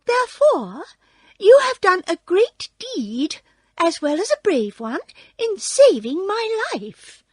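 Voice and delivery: high, loud, squeaky regal voice; mouse queen. A narrator's reading. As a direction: pronounce royal thanks as a little squeaky queen. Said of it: "added the Queen".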